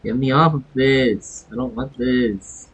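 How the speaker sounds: ordinary talk, nothing marked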